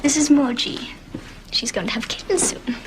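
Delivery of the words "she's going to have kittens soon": whispering. Real, not acted. Whispering a secret about the cat.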